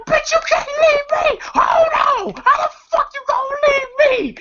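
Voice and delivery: high shrieking mock yell, laughing through it; Money Mike screaming. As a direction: shriek it after the departing car, half laughing. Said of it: "Money Mike screaming".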